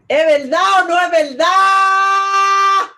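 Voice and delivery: slow, high, ringing shout; shouted challenge. Real, not acted. Asking the live audience, in a high slow shout. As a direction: hurl the question out to the crowd.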